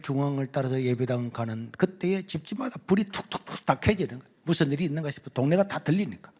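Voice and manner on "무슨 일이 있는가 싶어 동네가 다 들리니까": ordinary talk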